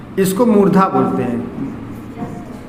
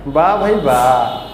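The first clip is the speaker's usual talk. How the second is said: mock praise; 6 semitones lower, slower than usual. Teacher mocks wrong names again.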